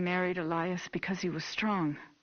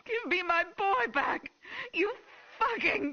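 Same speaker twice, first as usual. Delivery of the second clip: screaming; 13 semitones higher than usual. Acted. Mother fighting officer.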